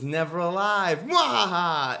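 evil villain cackle; slow gloating mwahaha; mad scientist. Pretend. Mock evil reveal laugh.

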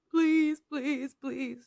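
begging Bree. Retold or imitated. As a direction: beg in a hammed-up whine. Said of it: "Bree's like".

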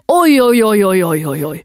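loud run of oj's sliding downward; imitated child prompting. Mother mimicking son feeding her the line, loud falling oj's. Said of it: "Och sen så säger han".

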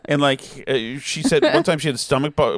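ordinary talk, nothing marked

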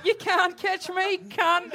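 high, slow sneer of a smug possum; taunting possum voice. Pretend. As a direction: tease like a possum out of reach. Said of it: "The possum being like".

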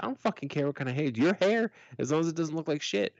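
mildly exasperated retort, rising in pitch. Retold or imitated. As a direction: act out a fed-up parent's quick brush-off. Retelling his exasperated answer to kids.

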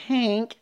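high, thin honk; imitated weak car horn. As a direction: mimic a feeble car horn. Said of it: "he honks the horn, and it's like".

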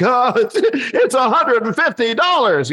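high, drawn-out comic wail; mock anguish. Broke comedian torn over the fee, anguished.

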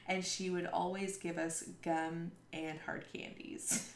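normal talking voice

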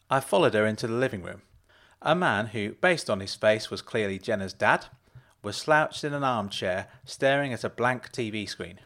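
usual speaking voice

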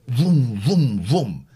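voiced vroom like a revving car; car vroom noise. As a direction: growl out a mock engine sound. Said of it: "she came out".

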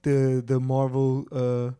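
put-on Jamaican and Irish mashup accent; melting pot accent, hammed up; mishmash accent. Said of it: "he's doing accents".

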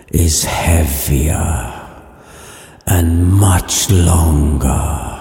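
slow, low, hushed ghostly moan; spooky ghost voice. Acted. Marley's ghost answering Scrooge, slow spooky low voice. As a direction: drag every word out like a chained ghost.

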